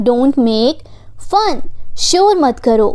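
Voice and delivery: ordinary delivery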